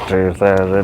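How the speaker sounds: flat robot voice reading the year. Pretend. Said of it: "Just like robot voice".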